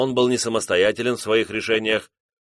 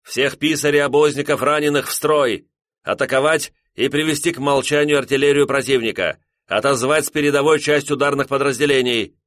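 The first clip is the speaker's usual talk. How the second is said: loud, higher barked orders; shouting orders over battle; 6 semitones higher, 4 dB louder than usual. Acted. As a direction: fire off commands over the explosions. General barking orders under shelling.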